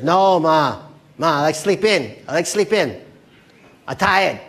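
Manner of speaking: hammed-up tired son; whining son. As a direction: complain like a kid dragged out of bed. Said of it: "the son who wakes up".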